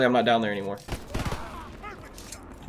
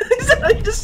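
laughing. Real, not acted. Laughing at an opponent's remark.

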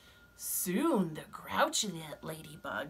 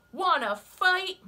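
high, loud, grumpy bug; grouchy ladybug voice; 12 semitones higher, 8 dB louder, slower than usual. Acted. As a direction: bark the challenge up high. Ladybug challenges rhino.

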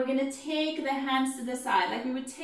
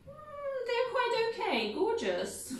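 very high cooing "mm" then gushing; doting Mrs Bennet; 12 semitones higher than usual. Shown as a demonstration. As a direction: gush over the youngest two. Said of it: "she's gonna go".

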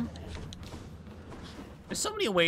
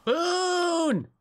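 excited. Real, not acted. Spotting a reward in game.

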